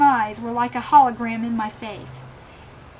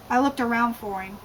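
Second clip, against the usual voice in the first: ordinary delivery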